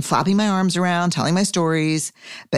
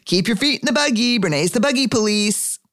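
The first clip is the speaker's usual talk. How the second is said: mocking sing-song; 8 semitones higher than usual. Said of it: "he was like".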